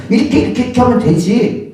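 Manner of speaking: hammed-up parent browbeating a child; a parent snapping at a clumsy kid; mock angry scolding parent. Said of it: "막 욱박질하면서".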